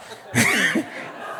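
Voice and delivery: 짧고 숨 섞인 웃음; airy chuckle. Real, not acted. Audience laughs, speaker chuckles.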